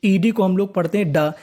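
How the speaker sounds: ordinary talk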